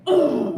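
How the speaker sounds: loud, mock-frustrated "ugh"; exasperated groan. Woman venting about a man she misses, exasperated groan. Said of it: "he make me so mad".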